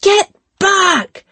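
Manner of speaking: shrill high scream, full force. Acted. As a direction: scream it to drive him back. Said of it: "she screams at him".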